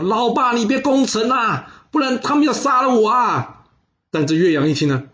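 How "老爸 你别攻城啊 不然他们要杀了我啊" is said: high, drawn-out wail for help; mock tearful cry. Bound son on the city wall to his besieging father, crying out. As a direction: plead with dad in a hammed-up wail. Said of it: "边哭边大叫的说".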